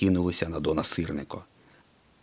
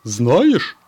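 higher, louder proud tow-truck voice; proud Mater; 7 semitones higher, 8 dB louder than usual. A narrator's reading. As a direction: boast like a cheeky old tow truck. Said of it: "гордо повідомив він".

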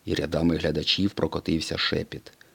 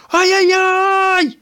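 extremely high, loud, slow frightened cry; panicked yell; 10 dB louder, much slower than usual. A narrator's reading. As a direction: yell in panic as they chase you. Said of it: "закричав блискавка".

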